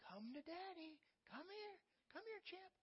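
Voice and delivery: put-on sing-song call to a toddler taking first steps; coaxing daddy. Father coaxing his toddler to walk to him, in high soft baby talk. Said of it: "So you're like".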